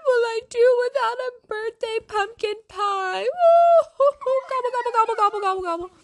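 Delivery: high wailing turkey gobbles. Acted. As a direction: wail like a sad turkey. Narrator voices sad turkey.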